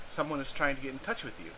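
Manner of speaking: ordinary delivery, nothing marked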